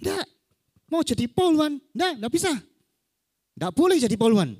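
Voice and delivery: high, loud indignant refusal; angrier mother. Acted. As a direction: shut the child down as an incensed mum. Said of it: "Mamanya tambah marah". (ibu tambah marah, melarang dengan nada tinggi)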